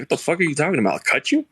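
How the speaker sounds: fast disbelief, cut you? jumping way up; retold shock. Recalling a hookup's weird request, fast incredulous rise. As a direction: recoil at a strange request.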